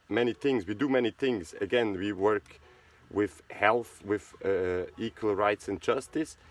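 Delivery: normal talking voice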